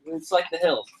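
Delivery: ordinary talk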